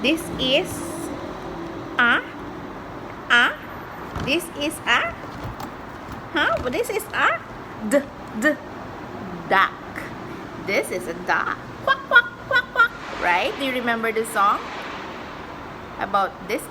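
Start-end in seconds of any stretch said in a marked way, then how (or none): 0.1-7.4 put-on high singsong for a toddler; reading to a toddler. 11.8-12.9 high loud quack quack; duck quacking. Acted. Duck noise for child.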